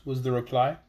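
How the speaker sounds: ordinary delivery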